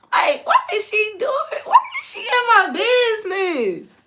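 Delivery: high, loud, laughing protests; laughing mock outrage. Griping to viewers about the coach, high laughing outrage. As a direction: squeal it in mock disbelief.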